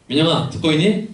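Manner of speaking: put-on preacher calling out playfully to the young man Minyoung. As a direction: shout it to someone far away. Calling out to a listener.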